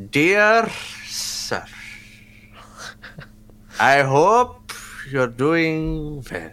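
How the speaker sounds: slow, lightly put-on Indian accent. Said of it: "do the Osho accent for him".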